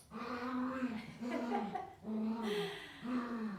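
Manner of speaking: soft chuckle; light warm laugh. Real, not acted. Laughs at dog.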